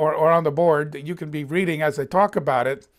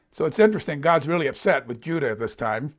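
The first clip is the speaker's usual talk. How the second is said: ordinary delivery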